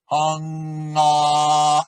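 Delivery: Hung ah with the ah held back in the throat; back-placed ah. Shown as a demonstration. Voice teacher demonstrating to students, Hung ah sung with the ah held back. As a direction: sing Hung ah keeping the ah back at the closure. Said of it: "keep the ah back where that closure is".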